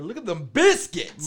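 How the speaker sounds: loud, high, drawn-out retort; hammed-up domestic squabble; mock indignant yell. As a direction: play up the outrage at full volume. Acted quarrel over empty pantry.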